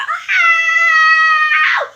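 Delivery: high, loud cry of pain; reenacted scream. Woman reliving being slammed against her car, screaming in pain. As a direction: scream out as the nerve is struck. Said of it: "I hollered out and I screamed".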